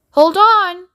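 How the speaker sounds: loud, high warning call; cry out. Acted. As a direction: yell to brace everyone. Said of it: "cried Mister Wonka".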